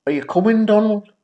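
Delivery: high, loud, cheery invitation; friendly mock call; upbeat call. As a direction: call him along brightly. Matt calling to narrator.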